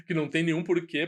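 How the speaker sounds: ordinary delivery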